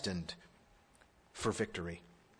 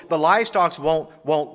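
usual speaking voice

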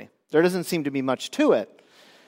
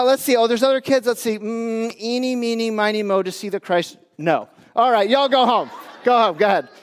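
mock disciple picking; 5 semitones higher than usual. Disciple picks kids, sends them off.

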